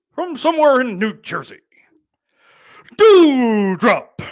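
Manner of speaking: high, booming wrestler introduction; ring announcer. Acted. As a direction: belt out the name, stretched, like a ring announcer. Finishes mock ring announcement.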